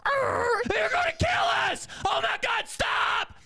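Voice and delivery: very high terrified shriek, hammed up; acted-out shrill panic; panicked screaming. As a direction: scream like a kid fearing a crash. Boy terrified in swerving car.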